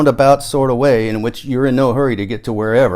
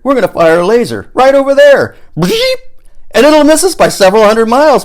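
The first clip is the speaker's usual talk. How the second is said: much higher and louder, with a high "bleep" sound effect; excited illustration; 13 semitones higher, 10 dB louder than usual. Acted. Paul acting out the enemy laser for his friend, excited. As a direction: act out the laser shot with relish. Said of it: "in excited illustration of our getaway".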